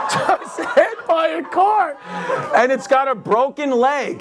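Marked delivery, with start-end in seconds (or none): 0.1-2.0 high, slow, through laughter; laughing shout. Real, not acted. Comedian relishing the dog getting hit, high laughing shout. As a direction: cackle out the punchline.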